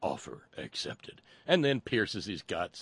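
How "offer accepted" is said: low, soft, flat alien voice; alien bug voice. Retold or imitated. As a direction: say it coldly like a creature in a man suit. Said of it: "the bug alien says".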